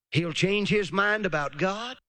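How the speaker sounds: ordinary talk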